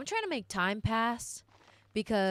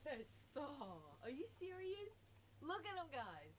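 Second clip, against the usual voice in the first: soft cooing; 19 dB quieter than usual. Real, not acted. Cooing over her cute dog.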